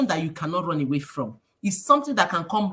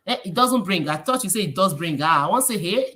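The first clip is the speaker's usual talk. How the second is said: usual speaking voice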